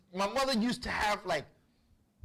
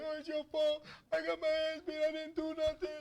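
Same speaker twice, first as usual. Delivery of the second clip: high, monotone put-on kid wail; whining little brother; 9 semitones higher than usual. Hit little brother blaming his sibling, high mock wail. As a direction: imitate a child wailing in blame.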